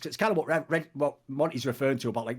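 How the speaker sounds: ordinary talk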